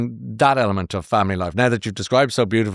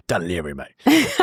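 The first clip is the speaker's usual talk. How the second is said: slow, drawn-out Australian twang; a jokey Australian accent; mock Australian accent; 4 semitones lower, slower than usual. Host jokingly imitating the guest's answer in an Australian accent. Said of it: "I went Australian".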